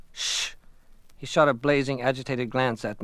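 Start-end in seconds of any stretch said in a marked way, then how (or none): 0.1-0.5 soft urgent shush; sharp hush. Acted. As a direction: hush him quickly so no one hears. Hushing friend as swimmers enter.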